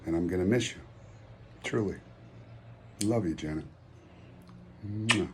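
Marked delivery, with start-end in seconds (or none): 4.9-5.3 blown kiss. Real, not acted. Saying goodbye to Janet with a blown kiss. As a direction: send a kiss off the end of the tribute.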